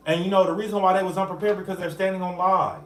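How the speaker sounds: ordinary talk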